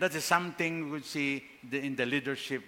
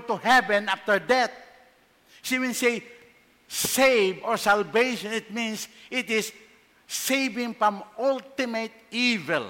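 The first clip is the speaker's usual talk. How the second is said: higher and louder, urging trust; fervent preaching; 6 semitones higher, 5 dB louder than usual. Acted. Pastor urging the congregation to trust Jesus, fervent. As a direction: lift it up, pleading with the flock.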